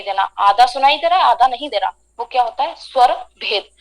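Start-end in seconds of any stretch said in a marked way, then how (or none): none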